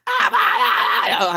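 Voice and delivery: mock high, clueless scream attempt; hammed-up untrained shouting like an idiot; idiotic shouting. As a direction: imitate a bad beginner's scream, high and drawn out. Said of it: "I was just shouting like an idiot".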